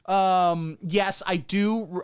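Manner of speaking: normal talking voice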